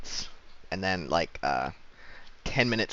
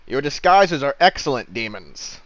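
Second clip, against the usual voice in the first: lightly voiced, louder guard figure; accusing armored guard; 3 semitones higher, 7 dB louder than usual. Armored figure addresses supposed demons over the wall, lightly voiced accusation. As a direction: declare it formally, a touch raised.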